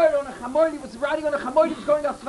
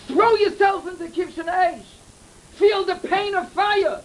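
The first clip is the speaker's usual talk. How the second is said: slow, higher, drawn-out urging; preacher urges the listeners slowly and higher; emphatic preaching; 6 semitones higher, slower than usual. Real, not acted. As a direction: lean into each word like a fiery exhortation. Preacher drives home the lesson.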